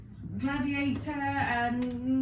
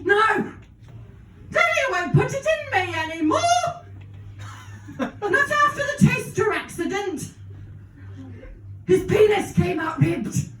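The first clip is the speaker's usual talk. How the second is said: wailing mock shout; 5 semitones higher than usual. Mother wails to her child about Daddy, mock-sobbing shout. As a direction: wail it like a distraught mum.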